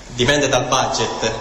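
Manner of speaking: usual speaking voice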